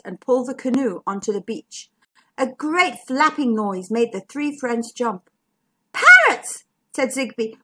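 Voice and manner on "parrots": excited. Acted. Zigbee startled by parrots.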